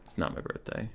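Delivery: breathy whisper, almost no voice. Pretend. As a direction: whisper it to yourself. Repeats denial of birthday.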